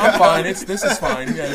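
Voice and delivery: loud, high mock reassurance; pretending it's fine. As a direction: insist all's well amid panic. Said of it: "that meme of that dog".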